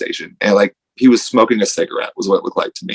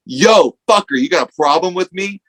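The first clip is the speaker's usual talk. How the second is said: mock tough-guy yell, higher and louder; aggressive challenge; 8 semitones higher, 3 dB louder than usual. Kid at the gas station challenges a stranger, shouting. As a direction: call the stranger out like a hothead. Kid retold picking a fight.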